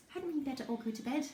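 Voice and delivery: put-on high, timid child's voice; little girl. Youngest sister interrupts the bedtime quarrel, high little-girl voice.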